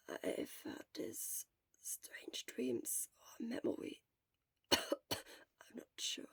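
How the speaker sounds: weak whisper; barely a breath, worn out; whispered from a sickbed. Acted. Sick Shura whispers throughout.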